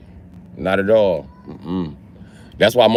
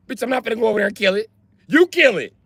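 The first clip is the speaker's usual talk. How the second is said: rapid high-pitched no way; fast high panicked refusal; 12 semitones higher, faster than usual. Retold or imitated. Refusing to go near the giant roach, fast and high.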